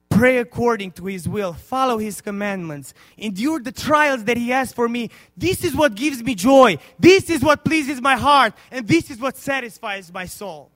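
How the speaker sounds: loud, rising preacher's climax; preaching at full force. Acted. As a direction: build the sermon to a loud cry. Preaches the joy of God.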